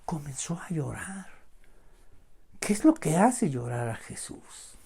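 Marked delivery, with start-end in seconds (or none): 0.1-1.3 very soft, breath-like near whisper; hushed and wondering; moved hush. Real, not acted. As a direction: let it out quietly, struck by it. Dwells on Jesus weeping.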